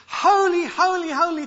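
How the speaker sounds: "Holy, holy, holy" rung out high and loud; emphatic repetition. Shown as a demonstration. Preacher explaining the threefold description of holiness, emphatic. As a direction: hit each "holy" hard, starting highest on the first.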